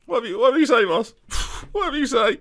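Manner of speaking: high voice, sighing sulk; sulky squeaky player. Acted. Striker sulks at his manager's answer, high sighing voice. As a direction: grumble it like a sulking striker.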